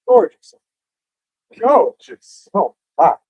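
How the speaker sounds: breathless shouting over wind. Real, not acted. Storm chaser awed by storm.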